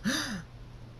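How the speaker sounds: sharp airy gasp; shocked gasp. A narrator's reading. Every pony reacts to the love confession, shocked gasp. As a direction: gasp in surprise. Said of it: "Every pony says".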